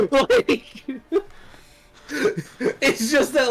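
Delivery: high, breaking into laughter while talking; words squeezed out through real laughter; giggling helplessly through the line; laughing. Real, not acted. Cracking up mid-story.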